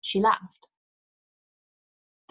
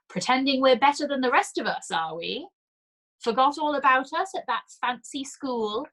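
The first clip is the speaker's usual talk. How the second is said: mocking; 6 semitones higher than usual. A narrator's reading. Old woman mocking the priest.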